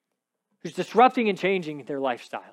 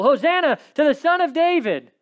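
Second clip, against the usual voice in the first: put-on children crying out; 9 semitones higher, 5 dB louder than usual.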